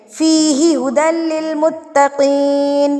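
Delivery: slow, measured Quran recitation; fresh-start recitation. Shown as a demonstration. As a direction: begin afresh on فِيهِ and draw out لِّلْمُتَّقِينَ. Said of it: "پھر آگے آیت کو شروع کریں گے".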